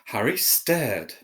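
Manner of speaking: ordinary delivery, nothing marked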